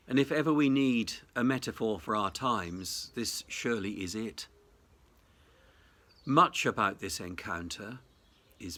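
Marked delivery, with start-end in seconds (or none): none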